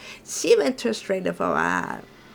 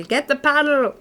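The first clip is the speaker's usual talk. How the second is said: shout; 6 semitones higher, 5 dB louder than usual. Acted. Calls for the paddle, louder.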